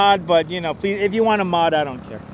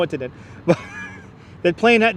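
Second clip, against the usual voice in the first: ordinary talk, nothing marked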